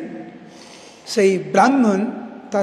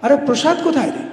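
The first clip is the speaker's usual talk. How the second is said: higher, louder startled question; a mother caught off guard by the empty plate; astonished mother; 6 semitones higher, 5 dB louder than usual. Acted. Mother, astonished, asks her son where the offering went. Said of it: "মা তো দেখে অবাক".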